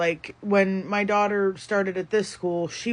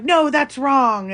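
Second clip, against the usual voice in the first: retelling a teacher's yell, louder, higher and slow; mock teacher yell; 6 semitones higher, 7 dB louder, slower than usual. Mother imitating the teacher who yelled at her daughter, mock yell. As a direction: snap it out like a teacher scolding a kid. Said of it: "and, like, was yelling at her".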